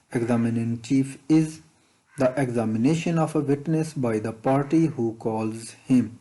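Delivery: slow, deliberate dictation pace; teacher reading an exam question slowly. Shown as a demonstration. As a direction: read it out word by word for students to follow. Reads exam question text aloud.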